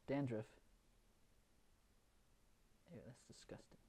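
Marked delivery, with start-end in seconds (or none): 2.9-3.8 grossed-out whisper. Real, not acted. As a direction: breathe it out in disgust. Grossed out at own dandruff.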